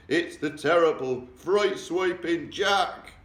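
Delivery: loud, slow, wailing giant voice; the giant howling about Jack in his nightmare; terrified giant bellow. A narrator's reading. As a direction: drag out every word in panic. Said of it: "bellowed the flesh lump eater".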